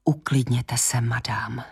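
hushed, calming whisper; doctor quietly calming a patient; spoken quietly. A narrator's reading. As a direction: soothe the patient in a low whisper. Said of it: "pronesl potichu".

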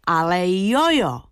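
slow, drawn-out mild outcry; defending Eda against the fourth-graders, a drawn-out firm exclamation. Acted. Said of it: "vykřikla jsem nahlas".

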